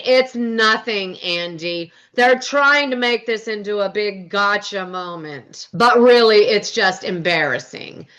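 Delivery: slow, drawn-out mimicked voice; Meredith. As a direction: imitate a measured dismissal, stretching the words. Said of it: "Meredith pipes up and says".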